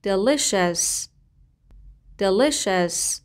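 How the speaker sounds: "Delicious" stretched out syllable by syllable; slow model word. Shown as a demonstration. Teacher modelling a word slowly so students can pronounce it. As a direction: draw out "Delicious" slowly and clearly. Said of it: "تونستین درست تلفظش کنین؟".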